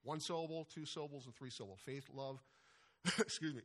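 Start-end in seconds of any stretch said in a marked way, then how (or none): 2.8-3.6 laughing apology. Real, not acted. Preacher laughs at his own slip.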